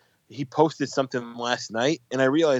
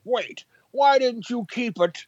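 mock whine; 9 semitones higher, slower than usual. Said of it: "he probably was like".

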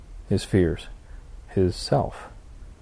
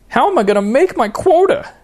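high, loud panicked wail; Harold panicking; 5 dB louder than usual. Acted. Harold wails to God about his quota. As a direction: whine it like a desperate salesman. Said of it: "Harold's panicked question".

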